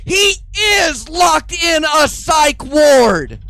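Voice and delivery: slow, high, loud comeback; deadpan punchline shout. Retold or imitated. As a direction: land it slow and loud, no beat missed. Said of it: "turns around and says".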